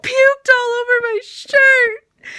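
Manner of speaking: loud, high-pitched hammed-up wailing; re-enacted bawling, very high and loud; mock sobbing. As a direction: wail it like an exaggerated crying meltdown. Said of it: "And I mean, just sobbing".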